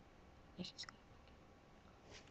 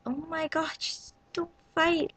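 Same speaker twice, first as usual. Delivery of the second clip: high pleading; 8 semitones higher, 4 dB quieter than usual. Retold or imitated. Begging boys to stop fighting.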